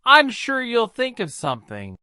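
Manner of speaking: a dragon drifting off, brushing it off; sleepy dragon. A narrator's reading. Dragon yawns at the worried boy, sleepy and soft. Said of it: "The dragon yawned".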